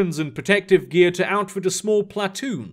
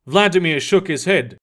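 ordinary delivery

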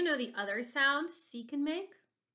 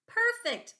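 high, bright praise; excited praise; 9 semitones higher than usual. Real, not acted. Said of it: "praise a child".

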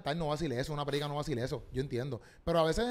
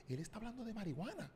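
mock church gossip; 2 semitones higher, 11 dB quieter than usual. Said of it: "es como que".

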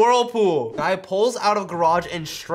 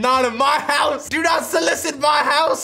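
mock yell; 7 semitones higher, 5 dB louder than usual. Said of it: "He said".

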